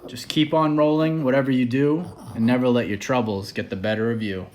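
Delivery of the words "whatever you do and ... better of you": ordinary delivery, nothing marked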